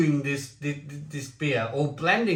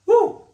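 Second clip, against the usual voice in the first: high whoop; 9 dB louder than usual. Real, not acted. Reaction to sour beer.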